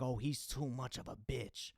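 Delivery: slow, whispered scoff; mock girl complaint. Girls complaining about a sensitive man, slow whispered scoff. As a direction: sneer it under your breath. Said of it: "it's like".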